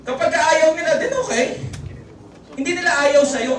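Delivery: ordinary delivery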